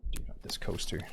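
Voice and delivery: hushed whisper, no voice. Real, not acted. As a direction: whisper it under your breath. Quiet aside during sneaky play.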